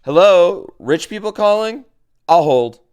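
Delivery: high, loud mock phone answer; pretend phone call. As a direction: play at answering a money-stack telephone. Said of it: "Use the money like a telephone".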